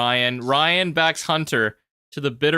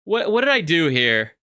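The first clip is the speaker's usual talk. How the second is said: self-doubt; 8 semitones higher than usual. Retold or imitated. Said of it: "It's just like".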